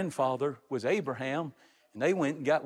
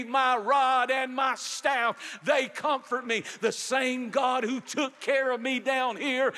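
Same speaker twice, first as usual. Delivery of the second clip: preaching at full force; 7 semitones higher than usual. Acted. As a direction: carry the church toward heaven in full preaching voice. Preacher voicing dying Jacob.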